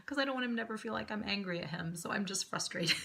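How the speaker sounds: ordinary talk, nothing marked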